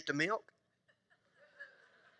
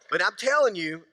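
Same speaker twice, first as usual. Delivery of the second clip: usual speaking voice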